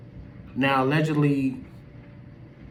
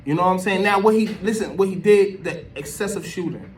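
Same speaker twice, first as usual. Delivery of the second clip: loud, high-pitched exasperated argument; worked-up rant; 8 semitones higher, 7 dB louder than usual. Real, not acted. Commentator scolding those who called the police, worked up and loud. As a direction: argue it heatedly, voice raised well up.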